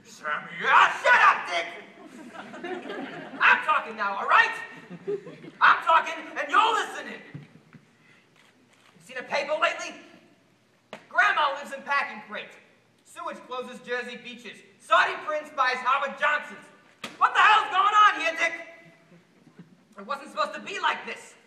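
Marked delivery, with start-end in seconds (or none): 0.6-7.2 loud, high, domineering yell; angry shouting. Acted. Voter telling Dick to shut up and listen, angry shout. Silences Dick, takes the floor. 14.8-18.5 loud, raised pitch, climbing to a yell; outraged rant. Acted. Voter reading bad headlines at Dick, outraged shouting. Rants headlines, demands an answer.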